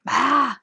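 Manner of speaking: exclaim, lost for words; overwhelmed cry. Real, not acted. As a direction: let out a loud wordless burst of amazement. Overwhelmed by the book's ending.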